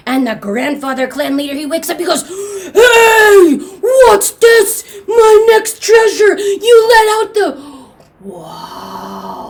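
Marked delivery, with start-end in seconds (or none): 2.2-7.6 high, loud indignant shouting; outraged grandfather. Acted. Grandfather wakes to his treasure let out, loud outrage. 8.2-9.4 soft, lowered, drawn-out wow; anger melting into quiet wonder; hushed awe. Acted. Grandfather seeing the moon mid-scolding, soft awed murmur.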